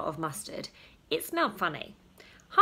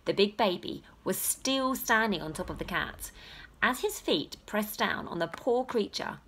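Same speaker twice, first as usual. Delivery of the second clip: ordinary delivery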